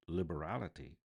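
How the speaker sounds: ordinary delivery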